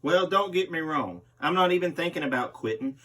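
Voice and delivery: usual speaking voice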